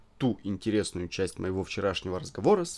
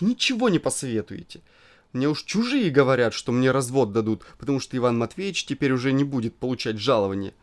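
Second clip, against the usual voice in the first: petulant scolding; 4 semitones higher, 5 dB louder than usual. Acted. Angry wife scolds narrator.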